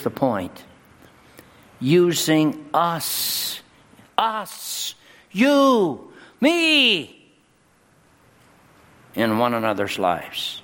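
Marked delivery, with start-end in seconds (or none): 1.8-7.3 slow start climbing to a high, loud peak on each word; building preacher's cry. Acted. Pastor pointing at the congregation, building to a high shout. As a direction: stretch out using us, then drive you and me higher and higher.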